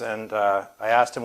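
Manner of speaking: normal talking voice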